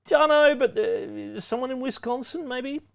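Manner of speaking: mock clueless. Host mocks the vague option C.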